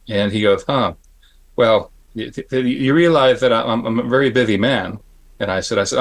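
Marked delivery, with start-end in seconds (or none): 0.6-5.0 imitated lisping, halting excuse; lightly lisped impression, hesitant starts; Dick Smith lisp. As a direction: stammer slightly, turning s into th. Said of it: "He had a little bit of a lisp".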